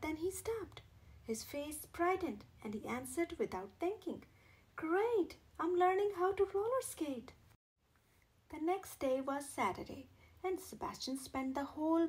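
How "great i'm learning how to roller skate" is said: higher and a bit louder, beaming; bright, eager answer. A narrator's reading. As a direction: burst out proudly. Shy boy answers brightly.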